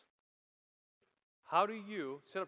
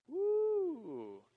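excited whoop; 12 semitones higher than usual. Acted. Preacher reacts to topic gossip.

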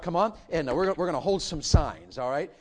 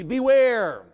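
loud warning; 7 semitones higher, 10 dB louder than usual. Acted. Preaching the warning.

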